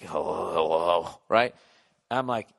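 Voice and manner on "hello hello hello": low sleepy hello; groggy answering. Retold or imitated. Friend woken at dawn answering the phone, low and sleepy. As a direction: sound half-asleep picking up the phone.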